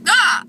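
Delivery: high squeaky hiccup; real hiccup yelp; loud hiccup. Real, not acted. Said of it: "that's my real hiccup".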